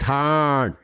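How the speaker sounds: Southern "Tire" flattened to one syllable, "tahr"; drawled Southern pronunciation of "Tire"; Southern accent. Shown as a demonstration. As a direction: stretch "Tire" into a Southern "tahn". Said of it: "try having a Southern accent".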